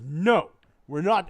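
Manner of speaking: loud, slow refusal; defiant refusal. Acted. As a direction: refuse it flat out, slowly and loud. Businessman refuses amputation in joke.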